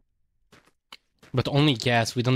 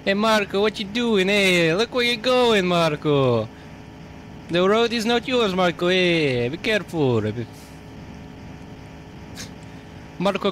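put-on a light New York Italian mafia accent, higher; hammed-up New York Italian mafia accent; 4 semitones higher than usual. Streamer to his Chihuahua, mock New York Italian mafia accent. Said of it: "Italian accent while talking to a Chihuahua".

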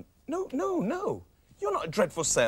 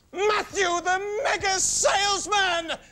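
high, slow, shouted boast; grand shout of a salesman fantasy; triumphant scream; 10 semitones higher, 4 dB louder, much slower than usual. Acted. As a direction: scream your dream to the world. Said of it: "you were screaming at the top of your lungs".

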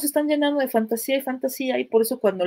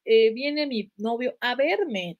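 ordinary talk, nothing marked

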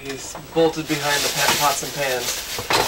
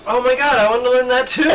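excited; 8 semitones higher, 5 dB louder, faster than usual. Real, not acted. Excited outburst at animal spell.

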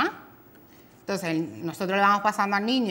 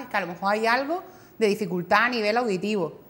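ordinary talk, nothing marked

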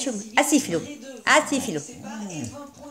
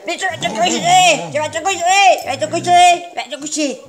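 high, loud scolding of the dog; 6 semitones higher, 6 dB louder than usual. Real, not acted. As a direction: tell the dog off.

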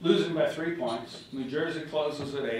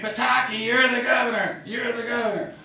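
retold shout, higher and louder; imitating a scream; 6 semitones higher, 4 dB louder than usual. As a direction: shout the good news like the chairman on the phone. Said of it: "he's screaming".

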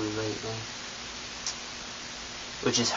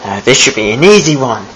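put-on British accent; 8 semitones higher than usual. Ghost narrating his trick to viewers in a British accent. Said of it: "talking in a British accent this whole time".

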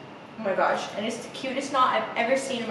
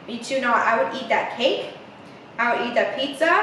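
usual speaking voice

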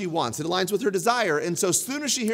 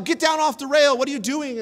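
higher, louder yell at his daughter; retold yell; 7 semitones higher, 5 dB louder than usual. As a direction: shout it like a scared dad. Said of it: "I would yell".

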